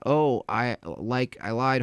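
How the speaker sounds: ordinary talk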